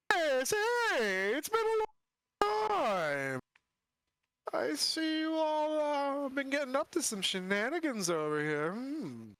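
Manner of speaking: creepy sing-song undead voice; Lich voice. Acted. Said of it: "You sound different".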